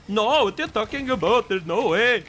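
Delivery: mock "Canadian accent". Shown as a demonstration. Streamer trying out the "Canadian accent" joke, parody Indian accent. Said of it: "I'm trying to do it".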